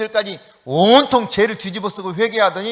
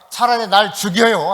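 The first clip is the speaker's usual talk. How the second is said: mock-petulant outburst, a bit louder; 2 semitones higher, 7 dB louder than usual. Jonah sulking at God, petulant. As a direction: act out a sulking prophet's demand.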